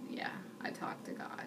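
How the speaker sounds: whispered, confessional aside; almost voiceless whisper; hushed admission. Retold or imitated. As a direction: drop to a whisper, as if admitting a secret. Shamefaced admission about talking to God.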